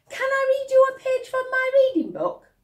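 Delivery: put-on a keen girl asking; eager girl. Laura asking mother.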